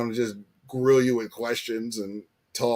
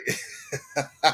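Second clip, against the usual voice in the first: laugh; 4 dB quieter than usual. Real, not acted. Laughs at own joke.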